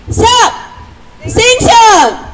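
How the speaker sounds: high, drawn-out rally cry; 구호 외치기. Real, not acted. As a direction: call it out slowly as a call-and-response slogan. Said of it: "스탑 하면 생션을 외쳐주십시오". (길게 끌어 외치는 구호)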